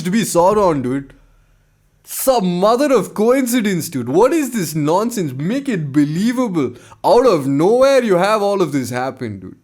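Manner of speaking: playful put-on Indian accent, "dude" as "tood"; faux Indian accent. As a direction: slip into a jokey Indian accent, excited. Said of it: "my faux Indian accent comes out".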